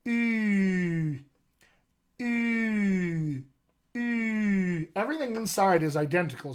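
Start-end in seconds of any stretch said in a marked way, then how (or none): 0.1-4.9 Ooh held long with rounded lips. Shown as a demonstration. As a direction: sustain a rounded ooh, same tongue as ee. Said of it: "I get something like this".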